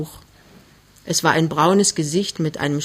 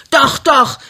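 high, loud contradiction; angry shout; 8 semitones higher, 8 dB louder than usual. Acted. Mother contradicts frightened suitor, shouting. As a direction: shout him down. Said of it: "schreit Frau Mörschel".

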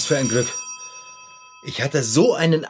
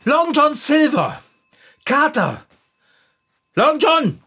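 higher and louder, calling across the room; 9 semitones higher, 3 dB louder than usual. Acted. Looking for the hiding cat, calling out loud. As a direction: call the cat from a distance.

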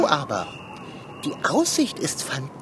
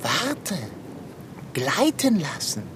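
ordinary delivery

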